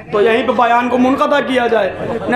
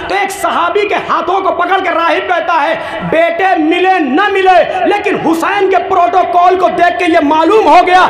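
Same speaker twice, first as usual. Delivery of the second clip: impassioned preaching; 4 semitones higher than usual. Acted. Preacher voicing the monk's awe to the mourners, ringing. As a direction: drive the story home to the crowd.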